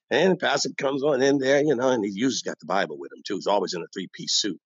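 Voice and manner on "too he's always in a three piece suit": normal talking voice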